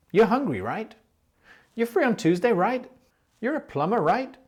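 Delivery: friendly rising "right?" tags; tag question right. Shown as a demonstration. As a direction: check casually with "right" at the end. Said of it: "you'll also hear the word right at the end".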